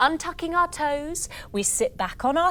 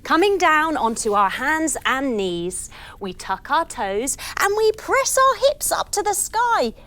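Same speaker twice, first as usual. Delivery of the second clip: ordinary delivery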